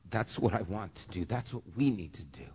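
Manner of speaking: real choked-up softness, dropping toward a whisper; soft and low, voice giving out with emotion; getting emotional. Real, not acted. Preacher humbled by people asking him for help, getting emotional. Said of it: "I'm getting emotional".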